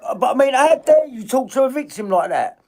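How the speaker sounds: high, loud indignant shout; how dare you, shouted in outrage; outraged. Real, not acted. Outraged at the lawyer's reply.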